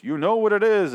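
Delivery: high, loud mock ghost yell. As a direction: imitate the ghost hollering. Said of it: "the ghost starts yelling at him".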